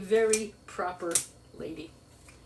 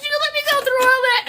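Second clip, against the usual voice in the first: high, loud, outraged squeal; mock-shrill outrage from a stunned mother; indignant mother; 10 dB louder than usual. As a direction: shriek it like a betrayed mother. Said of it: "she goes".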